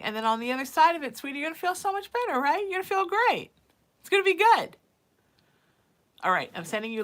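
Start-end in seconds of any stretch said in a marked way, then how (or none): 0.0-4.8 much higher, fast, rising to loud cheer; excited encouragement. Real, not acted. Psychic cheering Joy on about her move, high and fast. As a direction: lift her up, bubbling over with it.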